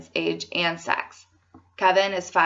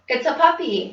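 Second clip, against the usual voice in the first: mild, higher-pitched story shout; 6 semitones higher than usual. A narrator's reading. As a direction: read it as a light shout. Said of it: "They shout".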